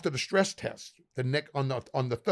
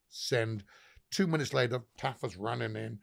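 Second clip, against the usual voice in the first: ordinary delivery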